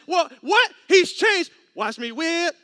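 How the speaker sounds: loud, high hyped celebration; hammed-up party cheer; celebrating brother. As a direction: whoop like a man showing off dance moves. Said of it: "I thought".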